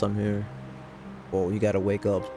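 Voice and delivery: ordinary talk, nothing marked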